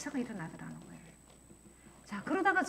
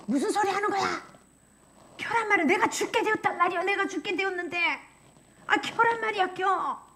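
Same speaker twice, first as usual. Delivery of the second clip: loud high frantic insisting; desperate angry shout; 8 semitones higher, 5 dB louder than usual. Retold or imitated. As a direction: scream it like her life depends on it. Woman insists, says she's dying.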